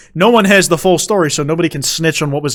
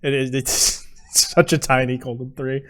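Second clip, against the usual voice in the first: usual speaking voice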